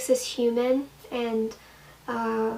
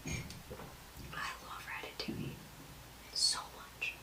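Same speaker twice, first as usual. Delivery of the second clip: whisper; 18 dB quieter than usual. Acted. Confessing her favourite movie.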